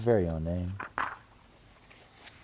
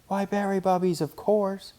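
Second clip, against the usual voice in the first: put-on little girl voice; 12 semitones higher, 3 dB louder than usual. A narrator's reading. Sister cub voice.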